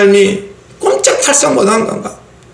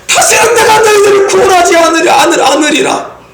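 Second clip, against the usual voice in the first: faltering angry God; 9 semitones higher than usual. Acted. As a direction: shout it but let it stumble, as if holding back. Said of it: "하나님의 마음이 진짜겠습니까? 아니죠". (더듬으며 외치는 하나님의 진노)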